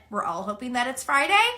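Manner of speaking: ordinary talk, nothing marked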